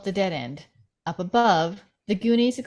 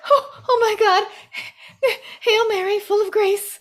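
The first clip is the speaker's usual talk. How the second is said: high, loud, half-crying prayer; panicked; 12 semitones higher, 5 dB louder than usual. Acted. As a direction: pray through panicked sobs.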